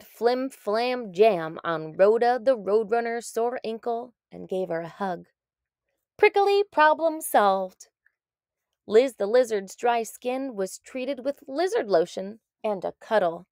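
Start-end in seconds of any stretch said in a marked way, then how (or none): 6.2-7.9 slow, emphatic catchphrase; storybook refrain. A narrator's reading. Narrator ending each case, slow triumphant refrain. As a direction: land the refrain like a punchline.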